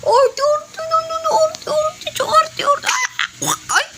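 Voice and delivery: very high, squeaky teasing voice; playful high baby talk. Pretend. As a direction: tease a fussy baby in a tiny high voice. Mimicking and teasing fussy baby. (napakatinis na panunukso sa baby)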